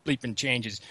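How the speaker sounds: "Wah" drawled the Boston way, then trailing off; mock Boston accent. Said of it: "I want the accent so bad".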